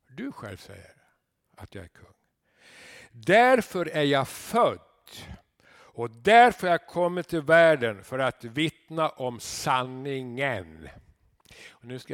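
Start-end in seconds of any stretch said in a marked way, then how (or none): 0.1-2.1 soft repeat. Acted. Pastor repeats Jesus's words. 3.1-10.9 loud, slow, solemn declaration; solemn proclamation. Acted. Jesus declaring his purpose to Pilatus, loud and slow.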